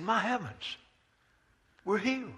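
a healed leper can't believe it; joyful amazement. Acted. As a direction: leper overjoyed at seeing his hands. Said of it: "and said".

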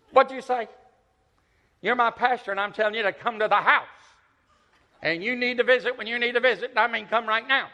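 mock stern elderly woman demanding; imitating a bossy old woman. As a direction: lay down the law to the pastor. Old woman scolding pastor on phone.

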